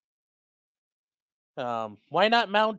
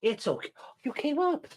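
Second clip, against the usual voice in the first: high, soft reassurance to a pet; calm a shaking puppy; soft, high pet talk; soothing the dog; 9 semitones higher, 7 dB quieter than usual. Real, not acted.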